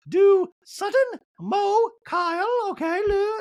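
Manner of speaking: loud falsetto roll call; Dorit. Retold or imitated. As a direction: call names out in a very high mock voice. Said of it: "And Dorit's like".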